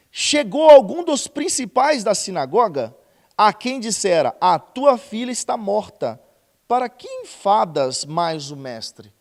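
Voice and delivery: loud, slow Bible reading; preaching scripture reading. Acted. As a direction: declaim the passage to the congregation. Reads scripture at full force.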